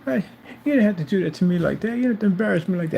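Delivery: high pleading voice mocking the seller; put-on whimpering voice, high and pleading; mocking whiny plea. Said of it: "sit there and go".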